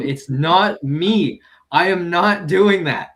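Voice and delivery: slow, loud, word-by-word denial; emphatic denial. Retold or imitated. Telling people he is not the prank caller, emphatic. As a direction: spell it out slowly, hitting each word. Retold fights denying prank calls.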